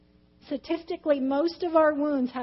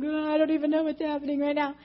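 mock tearful wail; retold crying. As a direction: imitate blubbering in confusion. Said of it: "started crying".

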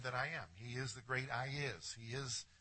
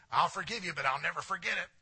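mock grudge; 5 semitones higher, 8 dB louder, faster than usual. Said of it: "People say".

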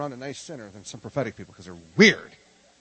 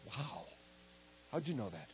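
softly awed mock reaction; soft, lower, imitated astonishment; hushed amazement; 3 semitones lower, 15 dB quieter than usual. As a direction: drop to a quiet, wondering voice. Acted amazed reaction to prophecy.

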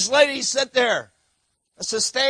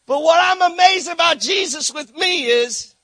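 preaching swell; 9 semitones higher, 9 dB louder than usual. Acted.

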